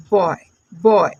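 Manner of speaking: बॉय stretched slowly, "bawww-y"; low, drawn-out teacher's बॉय; phonics demo. Shown as a demonstration. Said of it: "अब जैसे हम इसको पढ़ेंगे". (बॉय को खींचकर धीरे बोलें)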